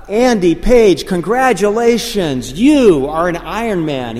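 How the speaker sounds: high, loud finish-line announcer; stretched, celebratory announcer call; announcer's yell. Retold or imitated. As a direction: call out congratulations over the PA. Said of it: "He would yell out things like".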